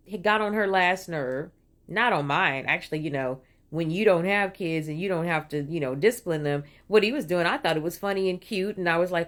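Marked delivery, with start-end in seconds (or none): none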